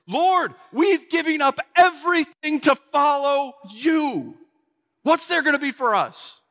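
high-pitched, pleading protest; worked-up disciple demanding an answer; Peter. Retold or imitated. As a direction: cry out an aggrieved appeal, stretching the words. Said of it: "Finally, Peter spoke up".

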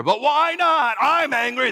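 a touch of petulant anger; mock frustrated parent. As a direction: play the exasperated parent, half joking.

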